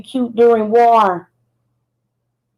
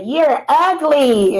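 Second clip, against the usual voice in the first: mock insult; 5 semitones higher, much slower than usual. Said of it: "they'll say".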